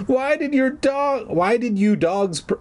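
very high, hammed-up frightened wail; choked out. A narrator's reading. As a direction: plead in a squeezed, high voice. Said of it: "I managed to choke out".

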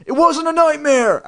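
put-on high-pitched child's shriek; frantic, very high cry. A narrator's reading. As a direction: shriek in indignant protest. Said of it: "I shrieked".

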